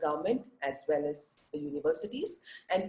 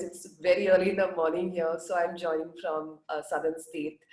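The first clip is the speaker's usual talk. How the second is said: normal talking voice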